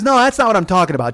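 loud, high mom imitation; mock exasperated mother. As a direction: imitate a mother impatiently correcting her son. Mother correcting son, acted.

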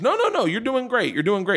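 high, eager reassuring producer; mock reassurance. Producer reassuring the nervous host, in a high eager voice. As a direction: imitate a producer soothing a struggling host.

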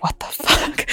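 unvoiced stunned what the fuck; shocked whisper. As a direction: breathe it out in disbelief. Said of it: "you just immediately are like".

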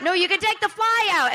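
high-pitched, exasperated outburst; exasperated protest. Acted. Diner arguing about a fly in food, exasperated high protest. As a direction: argue back, voice shooting way up.

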